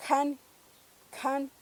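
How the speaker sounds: can with a puff of air after the k; aspirated k. Shown as a demonstration. Teacher saying a Purépecha word with aspirated k. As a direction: breathe out on the k of can. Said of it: "lo tenemos en palabras como".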